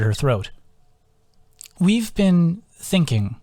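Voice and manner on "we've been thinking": slow, careful opening words; a slow, tentative start to bad news; hesitant start. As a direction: ease into it slowly, testing the water. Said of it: "she started".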